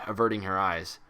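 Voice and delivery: ordinary delivery, nothing marked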